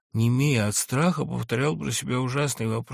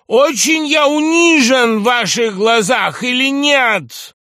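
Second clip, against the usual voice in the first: high, loud, wounded oratory; anguished shouted appeal; 13 semitones higher, 12 dB louder than usual. A narrator's reading. Humiliated general demands an answer from all guests, anguished shouted appeal. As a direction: shout slowly, stretching the key words in anguish.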